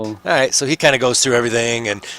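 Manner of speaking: ordinary talk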